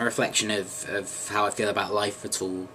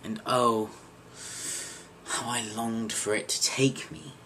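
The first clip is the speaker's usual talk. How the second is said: depressed monologue character. Acted. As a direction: perform a haunted confession, lingering between phrases. Performs own monologue as depressed character.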